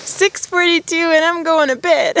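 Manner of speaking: high, laughing disbelief; amused at herself. Real, not acted. Laughing to viewers about an early bedtime, amused. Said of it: "I always laugh at myself".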